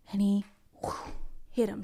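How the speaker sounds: hushed whisper at the climax. A narrator's reading. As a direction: breathe it out in suspense.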